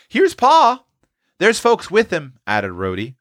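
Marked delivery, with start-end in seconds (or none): 0.1-0.8 put-on very high, loud child's announcement; calling out. A narrator's reading. Roxy spots father coming, high excited call. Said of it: "when Roxy called out". 1.4-2.3 high-pitched eager addition; excited child. A narrator's reading. Child adds news of visitors arriving.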